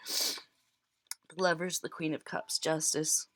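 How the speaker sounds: ordinary talk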